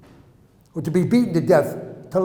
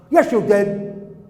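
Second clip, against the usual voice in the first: loud, drawn-out, raised accusation; accusing guard; 3 semitones higher, 6 dB louder, much slower than usual. Retold or imitated. Guard to the boy who denies it, angry accusation. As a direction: act out a guard shouting down a denial.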